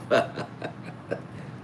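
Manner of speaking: breathy chuckle at himself; laughing. Real, not acted. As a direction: laugh quietly at your own joke.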